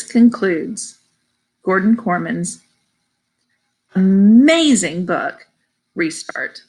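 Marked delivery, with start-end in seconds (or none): 3.9-5.5 AMAZING drawn out slowly and loud; emphatic praise. Real, not acted. Reader signing off a finished book, emphatic praise on "amazing". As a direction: stretch "amazing" with a big swell.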